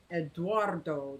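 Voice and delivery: "Eduardo" said slowly as ed-WAR-doh; slow, careful try at "Eduardo"; careful name. Shown as a demonstration. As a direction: sound out "Eduardo" syllable by syllable. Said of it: "Did I say that right?".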